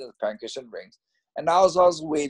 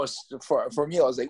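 normal talking voice

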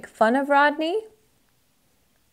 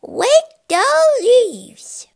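high, bolder rodent voice with w for r; emboldened little rodent; stronger squeak; 13 semitones higher, 6 dB louder, slower than usual. Acted. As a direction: squeak the command out with growing confidence. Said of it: "Wadney's voice was stronger".

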